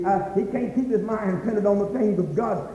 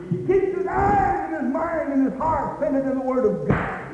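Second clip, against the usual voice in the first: high shouted proclamation; high ringing preacher's shout; full-force preaching; 7 semitones higher than usual. Real, not acted. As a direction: cry it out to the congregation.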